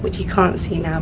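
ordinary talk

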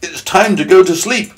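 high-pitched grasshopper proclamation; announcing old grasshopper. Acted. Old grasshopper sending everyone to sleep, high announcement. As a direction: declare bedtime in a high voice. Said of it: "the old grasshopper announced".

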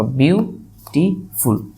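বিউটিফুল slowly, with pauses between three beats; syllable-by-syllable demonstration. Shown as a demonstration. Teacher counting syllables for students, বিউটিফুল broken into three. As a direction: say বিউ, টি, ফুল as separate pieces. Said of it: "একটা syllable হিসেবেই count হচ্ছে".

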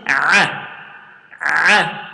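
a voiced as a squeezed throaty ayn; ayn sound. Shown as a demonstration. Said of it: "Repeat after me".

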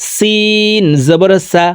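সিন and সা said thin, drawn out; thin letter. Shown as a demonstration. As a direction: keep the s of সিন light. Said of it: "সিন পাতলা করে উচ্চারণ করতে হবে".

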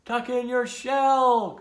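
high, loud, drawn-out holler; storybook crowd calling advice; shouted instruction. A narrator's reading. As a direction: shout it slowly up to someone. Turtles shouting instructions up to Tudley.

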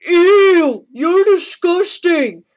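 hammed-up loud disgust; disgusted shriek. Figure disgusted at Big Show.

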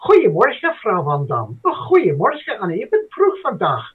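high, swooping Dutch greetings; Dutch language-tape intonation. Retold or imitated. Learner imitating the Dutch language tape, sing-song Dutch intonation. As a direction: exaggerate the Dutch tune, pitch leaping up and falling. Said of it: "the intonation is there".

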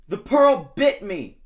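slow, loud, high wounded wail; hurt shark. Acted. Shark bitten on the nose, slow loud wail of pain.

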